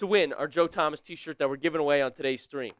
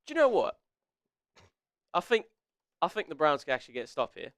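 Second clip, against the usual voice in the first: mock British accent; 3 semitones lower, 6 dB quieter, faster than usual. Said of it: "I'm gonna keep doing the British accent".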